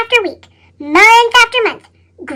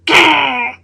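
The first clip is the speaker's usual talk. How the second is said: growl; 7 semitones lower than usual. A narrator's reading. Grumpy grape growls.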